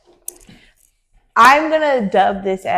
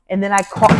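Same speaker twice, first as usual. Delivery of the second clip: normal talking voice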